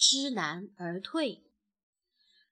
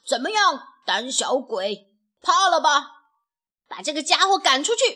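loud, higher war cry; rowdy taunting; 3 semitones higher, 7 dB louder than usual. A narrator's reading. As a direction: challenge the enemy, then rally the gang. Young roosters taunting the enemy. (气势汹汹地叫喊)

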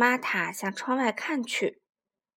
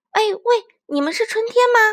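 high, loud hopeful call; excited child; 10 semitones higher, 8 dB louder than usual. Acted. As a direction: ask eagerly. Kids calling to creatures.

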